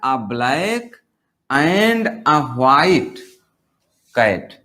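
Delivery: words stretched out one by one; slow dictation. Shown as a demonstration. Teacher reads the written example, very slow. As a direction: drag the example out slowly for the class.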